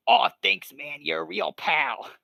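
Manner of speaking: loud, broad tough-guy cartoon voice, slowing at the end; hammed-up wrong voice for Pikachu; bad Joey Wheeler. Pikachu thanks Ash, in a bad Joey Wheeler impression. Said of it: "kinda like a bad Joey Wheeler impression".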